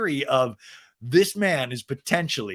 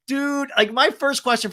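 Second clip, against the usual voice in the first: high dude; 11 semitones higher, 4 dB louder than usual. Retold or imitated. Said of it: "I'm like".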